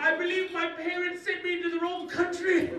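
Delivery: high, loud British schoolboy; shocked British accent. Acted. New boy in the wrong class, shocked British accent. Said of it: "And I was shocked".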